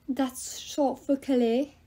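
slow, put-on French accent; fake French accent. A narrator's reading. Love god explains his brother's name, fake French accent. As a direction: explain it slowly in bad French-accented English. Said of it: "he had a French accent so bad".

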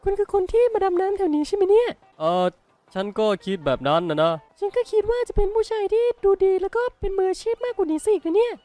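put-on high falsetto young woman, chatty and curious; Ellie's voice. Gamer voicing the diver Ellie to the player, high falsetto. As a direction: squeak it up high like a cheerful girl diver.

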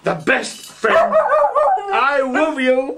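very high, slow, tender cooing; doting dog talk. Real, not acted. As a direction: gush over your best friend, slow and high. Cooing love to his dog.

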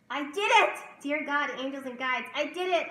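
loud, high exultant cry; overjoyed, announcing a victory; triumphant. Acted. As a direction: shout it out in joyful triumph. Reading out her joyful journal prayer.

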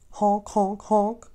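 high honk honk honk; swan honks. A narrator's reading. Swans honk at the crying duckling, high and bright. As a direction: call like a flock of swans.

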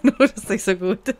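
giggly rhyming singsong; laughing singsong. Real, not acted. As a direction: laugh and sing the rhyme back. Echoes friend's rhyme mockingly. (lachend im Singsang)

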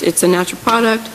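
usual speaking voice

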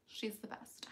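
breathy whisper. Real, not acted. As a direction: whisper it like a secret. Whispered after gasp.

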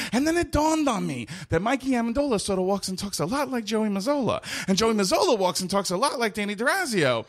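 comic monologue building from brisk recital to high-pitched frantic rant; audition monologue. Shown as a demonstration. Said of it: "I could do it".

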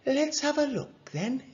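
high-pitched doctor starting an exam; doctor at bedside. A narrator's reading. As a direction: reassure a young patient. Doctor examines the sick boy.

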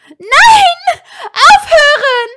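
very high, loud desperate scream. A narrator's reading. Hermine dragged away by Aurors, screaming. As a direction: scream it with all you have. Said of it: "schrie sie".

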